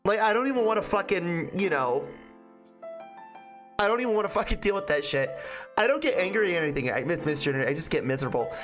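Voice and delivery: normal talking voice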